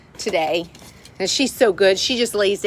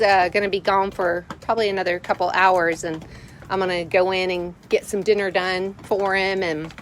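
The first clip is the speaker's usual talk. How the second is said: ordinary talk, nothing marked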